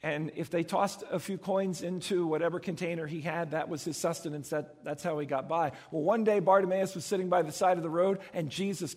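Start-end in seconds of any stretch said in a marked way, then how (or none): none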